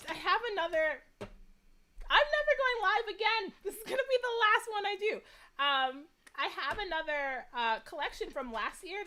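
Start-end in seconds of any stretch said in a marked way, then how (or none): 1.2-5.1 teary mock threat. Real, not acted. Tearful mock threat to viewers.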